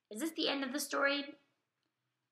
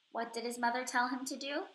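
ordinary talk

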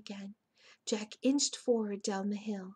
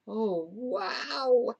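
drawn-out, louder awe; amazed; 6 dB louder than usual. A narrator's reading. Annie staring over the hill, amazed. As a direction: let the wonder swell out loud.

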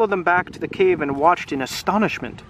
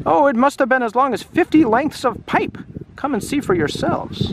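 high, breaking the big news; excited character voice; 8 semitones higher than usual. Acted. Menzie calling villagers to come and see, excited. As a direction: urge the villagers on, pitched well up.

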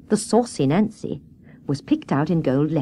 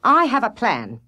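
mildly irritated announcement; slightly raised, grudging tone; rather cross; 5 semitones higher than usual. A narrator's reading. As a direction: state it with a touch of crossness. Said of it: "Water Rat spoke rather crossly".

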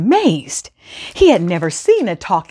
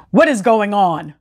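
ordinary delivery

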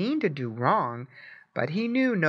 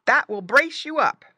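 put-on high, louder boy's voice; boy encouraging; 9 semitones higher, 5 dB louder than usual. A narrator's reading. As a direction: cheer the pet on as a boy. Boy's speech voiced in story.

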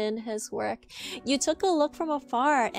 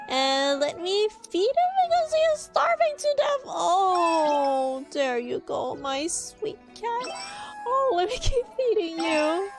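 high cooing over kittens; excited anime fan; 9 semitones higher than usual. Real, not acted.

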